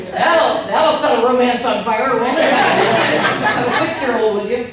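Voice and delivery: loud, high, laughing ironic remark; laughing sarcasm. Real, not acted. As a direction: say it laughing at the joke. Joking about ruined romance.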